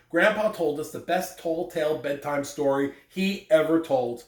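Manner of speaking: normal talking voice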